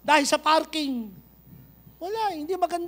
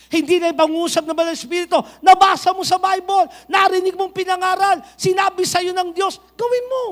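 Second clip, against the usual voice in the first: shouted, higher preaching build; fiery preaching; 5 semitones higher, 6 dB louder than usual. Acted. Pastor urging the church to obey, shouting. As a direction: drive it home to the congregation at full volume.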